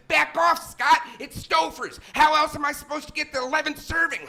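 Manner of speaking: high, loud comic outburst; defensive shout. Acted. Lasagna eater telling Scott off, high defensive shout. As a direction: yell it in hammed-up indignation. Snaps back at friend questioning him.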